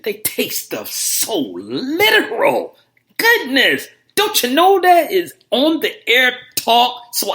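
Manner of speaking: high, loud, drawn-out mock exasperation; exasperated rant. Real, not acted. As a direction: scold the caller with theatrical disbelief. Host exasperated at a literal-minded caller.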